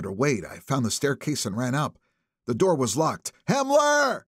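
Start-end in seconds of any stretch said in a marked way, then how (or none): 3.5-4.2 yell. A narrator's reading. Drunk man yelling name.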